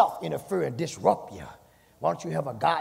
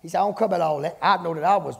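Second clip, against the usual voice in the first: louder, high preaching build; preaching at full force; 4 semitones higher, 6 dB louder than usual. Acted. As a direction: drive it home, rising.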